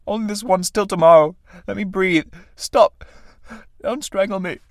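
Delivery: high, strained begging, climbing; desperate pleading. Acted. Tramp begging death to let him live, high desperate plea. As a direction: beg for breath, pitch rising to the end.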